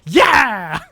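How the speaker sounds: loud, high battle cry; big, hammed-up whoop; imitated gleeful yell. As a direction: yell it as she throws a punch. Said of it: "and she's like".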